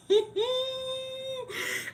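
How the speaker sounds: very high, drawn-out laugh. Real, not acted. Laughing at the joke about confessions, high laugh. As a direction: let out a high squeal of laughter.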